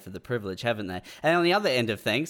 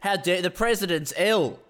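higher, indignant how dare you; outraged MAGA mock; 7 semitones higher than usual. MAGA fans outraged Trump was sick, high indignant mock. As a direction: play the outraged Trump fan, pitched up. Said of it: "they're like".

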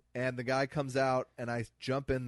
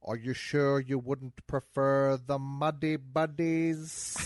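slow, languid villain voice; Alan Rickman impression; 3 semitones higher, 3 dB louder, much slower than usual. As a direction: drawl it like Rickman. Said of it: "Pool Party at Alan Rickman's House".

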